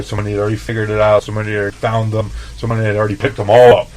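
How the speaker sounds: rapid list. Retold or imitated. Repeated build-up list.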